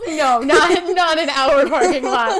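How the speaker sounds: can't stop laughing while objecting; blurting it out through a loud laugh; loud laughing protest. Real, not acted. Friend laughing loudly at story.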